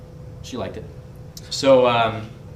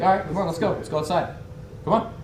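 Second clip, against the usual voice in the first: retold acceptance; 7 semitones higher than usual. Narrator accepting the dare to go outside, higher but not shouting. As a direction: take up the dare without shouting. His own unfazed reply in quarrel.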